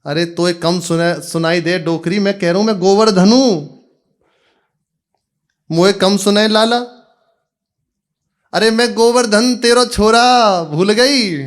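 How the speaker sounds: shouting to deaf mother. Acted. Son shouts to his deaf mother.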